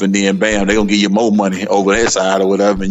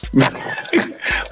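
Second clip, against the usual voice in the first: breathy chuckle; quick amused laugh; short laugh; 4 semitones higher, 6 dB quieter than usual. Real, not acted. Laughing after joke.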